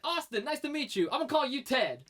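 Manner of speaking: high, hammed-up Southern accent. Wrestler renaming the man he meets, imitated Southern accent. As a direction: imitate a hearty Southern greeting. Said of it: "he has a southern accent".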